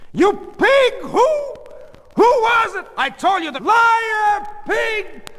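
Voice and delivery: very high, loud accusing shouts; furious screaming. Acted. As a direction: scream accusations at a cheating husband. Wife screams accusations at husband.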